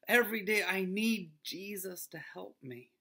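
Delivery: ordinary delivery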